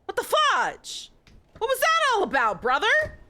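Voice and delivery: stunned, demand to know what just happened; shocked outrage. Real, not acted. Reacting to the jump scare.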